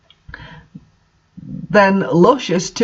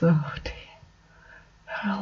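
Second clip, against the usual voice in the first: breathy whisper, far softer; whispered aside; 13 dB quieter than usual. Real, not acted. Reader muttering to viewers before carrying on, whispered. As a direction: breathe it out under your breath. Aside after absurd passage.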